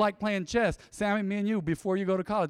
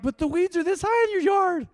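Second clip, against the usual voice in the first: high, loud mock disbelief; incredulous retort; 12 semitones higher, 5 dB louder than usual. Pastor mocking bored folks with overgrown yards, incredulous. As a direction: act amazed they could be bored. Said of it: "I'm like".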